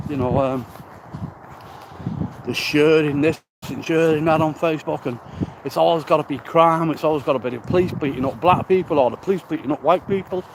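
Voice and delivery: usual speaking voice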